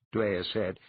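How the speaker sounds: ordinary delivery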